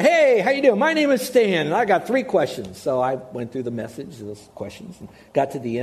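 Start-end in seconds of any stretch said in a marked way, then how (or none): 0.0-2.8 bright, loud, high hello to a stranger; cheery loud greeting. Retold or imitated. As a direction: burst in with a big friendly hello. Greets man in garage.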